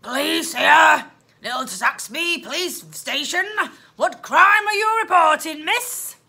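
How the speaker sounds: loud gruff police voice; loud, growly telephone policeman; growling policeman. A narrator's reading. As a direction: bellow it like a growly desk officer. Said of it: "a much deeper one, so deep it was almost a growl".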